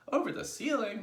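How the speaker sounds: high, loud astonished question; incredulous cry. A narrator's reading. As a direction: cry out in disbelief as youngsters. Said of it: "they cried".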